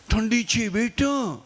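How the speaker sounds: put-on slow, drawn-out plea to the child; fussing mother, slow. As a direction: stretch બેટા like a worried mom.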